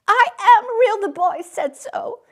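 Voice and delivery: high, loud desperate repeat; desperate insistence. A narrator's reading. Little rabbit repeating his protest, loud and desperate.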